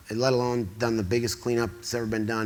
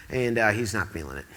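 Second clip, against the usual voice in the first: normal talking voice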